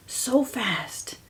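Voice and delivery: breathy whispered aside; hushed whisper. Retold or imitated. Recalling mom in bed, whispered. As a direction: drop to a whisper for emphasis.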